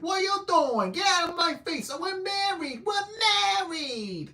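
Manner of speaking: put-on loud, high shrill New York accent; hammed-up New York yelling. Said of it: "throwing her New York accent around".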